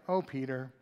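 dismissive sigh. Pretend. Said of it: "he didn't just like".

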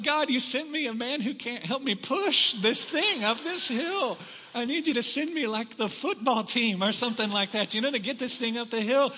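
high, loud mock gripe to God; griping complaint. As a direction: complain heavenward, playing up the exasperation. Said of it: "starting to gripe in my heart".

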